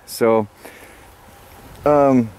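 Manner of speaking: ordinary talk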